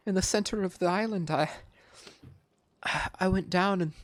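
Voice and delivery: high, shaky-voiced answer; tearful confession. Captive tearfully telling Petal where the necklace came from. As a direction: confess between sobs.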